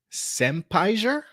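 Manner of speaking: puzzled slow rising question; baffled by the name; surprised. Real, not acted. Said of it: "Okay, interesting".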